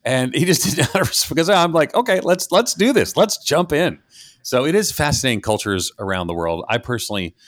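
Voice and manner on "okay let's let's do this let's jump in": pitched-up, game-for-it reply; eager retort. Retold or imitated. Speaker taking on an uninvited critic, eagerly combative. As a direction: jump at the challenge, voice high.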